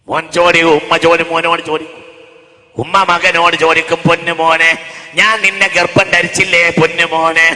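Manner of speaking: loud pleading mother voice; mother begging her son, preached loud. Acted.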